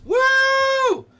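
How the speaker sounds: high, loud whoop; cheer. Real, not acted. Streamer cheering for the prayer request news, loud.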